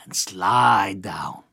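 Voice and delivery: hushed whisper, slow. Acted. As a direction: breathe the words out softly. Whisper slide down.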